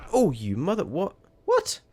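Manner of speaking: jolted by something on screen, cry out; shocked outburst. Real, not acted. Sudden shock in the game.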